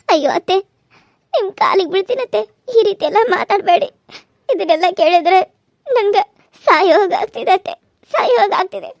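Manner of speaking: tearful pleading. Acted. Daughter-in-law begging her mother-in-law at her feet, tearful and pleading. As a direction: beg her mother-in-law not to speak so, near crying.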